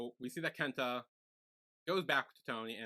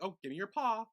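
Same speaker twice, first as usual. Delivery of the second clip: higher, playful command to a dog; mock dog owner; 7 semitones higher than usual. Owner asking the dog for its paw, lightly coaxing. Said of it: "when you have a dog and you're like".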